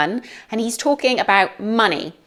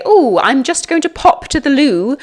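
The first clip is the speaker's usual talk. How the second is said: higher, louder, hammed-up polite Brit; polite loo-goer; 7 semitones higher, 6 dB louder than usual. Teacher imagining what the cool rapper wouldn't say, hammed-up polite. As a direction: chirp it out, high and loud, mock-polite. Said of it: "he's probably not going to say".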